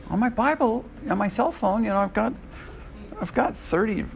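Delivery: high, whiny excuse-maker; mock excuse voice. Teacher mimicking a phone reader's excuses, high mock voice.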